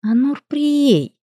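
high, very drawn-out name call; controlled, slow, warning call; forced calm. A narrator's reading. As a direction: summon by full name, stretching it out. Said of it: "как можно спокойно произнесла я".

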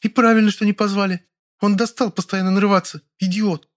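high, cross female voice; mildly angry high-pitched rant. A narrator's reading. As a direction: keep grumbling angrily up high. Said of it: "сердито ответила Лёля".

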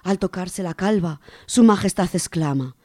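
normal talking voice

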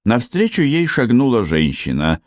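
ordinary delivery

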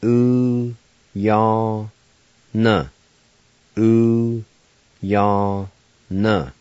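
Ooh, yaw, na as three separate beats, ooh held; Cherokee syllables. Shown as a demonstration. Teacher sounding out the Cherokee word for wire. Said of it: "Word number five, wire".

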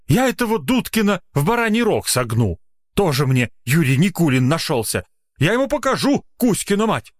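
high-pitched fuming threats; angered. A narrator's reading. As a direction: vow revenge, pitch jumping up. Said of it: "разозлился Маргуля".

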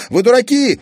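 put-on high, loud boyish outburst; angry boy. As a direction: hurl the insult. Kazik insulting.

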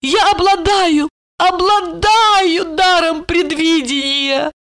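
high, drawn-out boast; grand drunken proclamation. A narrator's reading. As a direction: proclaim it like a tipsy prophetess. Drunk woman proclaims her gift.

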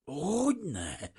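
high, eager exclamation; delighted agreement. A narrator's reading. Refugee relieved to be given a word, delighted. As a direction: seize gladly on the word, pitched high. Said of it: "Örvendezett meg Vrana Bazil".

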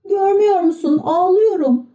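put-on high, plaintive young puppet; boyish puppet voice. A narrator's reading. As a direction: sad little wooden boy. Pinokyo says he is crying.

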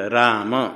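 राम as raa-ma, two syllables; final vowel sounded. Shown as a demonstration. Teacher corrects students, राम with final अ sounded. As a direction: voice a short अ after the म in राम. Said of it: "Sanskrit में जब उच्चारण करेंगे तो".

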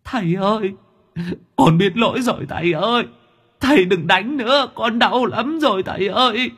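put-on very high child's wailing plea; tearful begging. A narrator's reading. Beaten ghost child begs the master, wailing tearfully. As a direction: whimper and beg in a high, crying voice. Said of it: "mới mếu máo khóc lóc".

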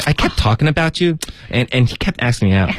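put-on high, gossipy girlish whine; Gossip Girl style. Teacher replaying the girl's complaint for the co-host, Gossip Girl style. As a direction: start high, like a teen-drama girl venting. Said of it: "他们都是这样说话的".

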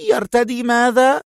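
high, disbelieving woman's voice; shocked nurse. A narrator's reading. Nurse hears he is dressing, high and shocked. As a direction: gasp the question. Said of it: "مرتاعة".

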